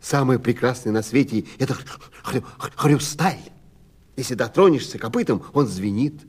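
loud piggy voice with grunts; grunting piglet. Acted. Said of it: "запротестовал поросёнок Кнопка". (громкое поросячье хрюканье)

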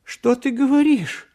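very high, loud wail; shrieked, appalled question from a mother. A narrator's reading. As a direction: cry out in horror, voice shooting up. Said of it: "заголосила она".

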